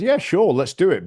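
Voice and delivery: high, bright-voiced yes that drops into a catch; mock eager partners. Partners agreeing to the New York office in a high mock voice. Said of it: "they said".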